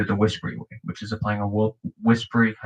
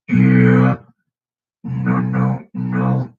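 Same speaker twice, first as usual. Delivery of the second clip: lower, louder growling bass words; vibration bass; 9 semitones lower, 6 dB louder, much slower than usual. Shown as a demonstration. Beatboxer demonstrating vibration bass to learners, low loud growl. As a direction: growl the words with a rattling false-fold bass. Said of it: "Vibration bass is also caused".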